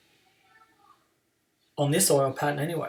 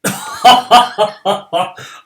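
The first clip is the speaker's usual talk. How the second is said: real loud laugh; 7 semitones higher, 14 dB louder than usual. Real, not acted. Laughs at opponent's spares.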